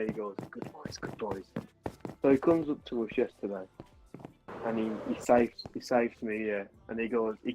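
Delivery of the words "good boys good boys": soft, low-key imitation of whispering; retold whisper. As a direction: drop the volume, mimicking his whisper. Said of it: "He whispers to them".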